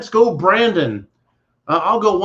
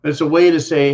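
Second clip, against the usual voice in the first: ordinary talk, nothing marked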